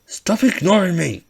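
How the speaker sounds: high, loud bully snarl; angry shout. Acted. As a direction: shout it in his face. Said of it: "Katsuki said".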